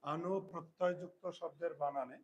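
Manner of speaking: slow and spaced for writing down; teacher dictating the rule to pupils, slow; slow dictation. Shown as a demonstration. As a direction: dictate word by word.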